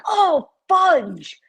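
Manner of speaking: 3 blurt it out as if caught off guard; 1 mock shocked exclamation. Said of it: "And I'm like".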